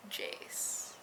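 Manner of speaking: breathed whisper of the name. Real, not acted.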